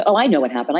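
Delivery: ordinary talk, nothing marked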